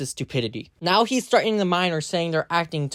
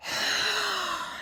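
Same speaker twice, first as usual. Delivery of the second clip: exasperated sigh; 7 dB quieter than usual. Real, not acted. Exasperated at opponent.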